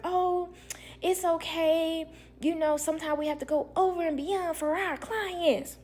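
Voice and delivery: high, sugary customer-service voice; mock chipper agency voice. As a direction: sing it sweetly like a brush-off. Agency's dismissive reply mocked.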